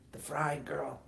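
normal talking voice

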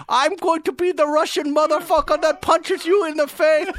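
high, loud mock Middle Eastern threat; hammed-up scammer tirade in a Middle Eastern accent; angry Middle Eastern accent. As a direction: rant menacingly in a put-on Middle Eastern accent. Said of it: "angry Middle Eastern guys".

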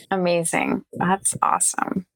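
ordinary talk, nothing marked

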